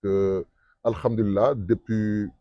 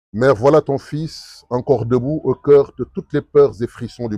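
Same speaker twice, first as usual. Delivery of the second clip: poem recital; 4 dB louder than usual. Shown as a demonstration. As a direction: recite with rising passion. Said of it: "je vais le déclamer juste une partie".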